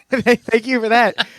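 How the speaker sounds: ordinary delivery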